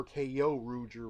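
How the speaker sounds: "Kyo" said as KAY-oh; pronounced kay-oh. Shown as a demonstration. Reviewer offering viewers possible pronunciations, "Kyo" as kay-oh. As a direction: say "Kyo Ruger" as KAY-oh ROO-jer, slowly. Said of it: "which one you want to n-, pronounce".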